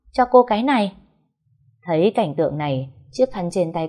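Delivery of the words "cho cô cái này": higher, louder urgent offer; hurried. A narrator's reading. Minh bursting in to hand her a towel, urgent. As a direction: rush the words in. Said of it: "giọng cậu Minh gấp gáp".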